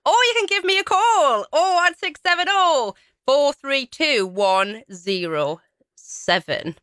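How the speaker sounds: high, lilting announcer delivery; upbeat presenter retake. Real, not acted. Radio host retrying the phone number after fluffing it, upbeat and high. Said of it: "Let's try that again".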